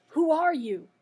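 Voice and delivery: loud, high challenge; crying out. A narrator's reading. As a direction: cry out to confront a stranger. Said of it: "cried out".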